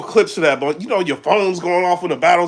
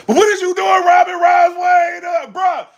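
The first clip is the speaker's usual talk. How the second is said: high, loud disbelieving call-out; stunned shout at the rapper; incredulous shout; 13 semitones higher, 4 dB louder than usual. Retold or imitated. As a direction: yell it like you can't believe what you're seeing. Outraged at battle rapper.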